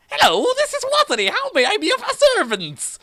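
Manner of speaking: very high, fast answering voice; squeaky phone voice. Acted. Watery answering the phone in a silly high voice.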